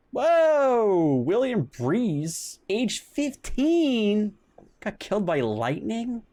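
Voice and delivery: loud high whoa, slowing in disbelief; shocked disbelief. Real, not acted. Streamer stunned reading of a boy killed by lightning. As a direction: gasp it out, then spell the news slowly.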